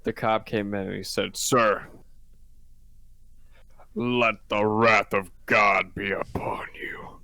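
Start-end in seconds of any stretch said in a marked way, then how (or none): 1.4-7.2 slow, mock-epic pronouncement; wrathful cop. Cop pronouncing God's wrath on a thief, mock-epic. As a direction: intone it slowly like divine judgment.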